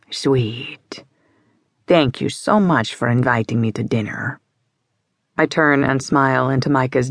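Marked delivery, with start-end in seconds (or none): 0.1-1.0 breathy whispered sweet in a Russian-British voice; whispered endearment. Acted. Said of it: "Russian-British accent". 1.9-4.4 put-on Russian-British accent. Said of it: "a perfectly distinctive Russian-British accent".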